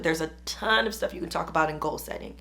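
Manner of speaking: normal talking voice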